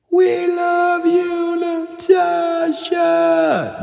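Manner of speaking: slow, high, stretched-out yell; long yell. Retold or imitated. Scouts yelling to the girl at morning assembly, drawn-out holler. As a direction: holler it across the valley, dragging every word. Dad retells hearing the yell.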